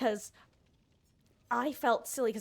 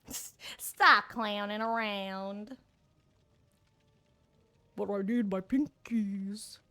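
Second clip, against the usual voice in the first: slow, lightly put-on clown voice; much slower than usual. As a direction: drag the words out like a goofy clown. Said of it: "why is my clown voice".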